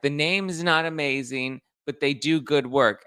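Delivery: softening the blow; diplomatic Kyle. Retold or imitated. Kyle calmly defending the charity in a lightly put-on voice. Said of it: "Kyle's like".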